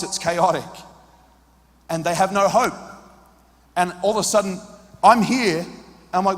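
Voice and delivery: usual speaking voice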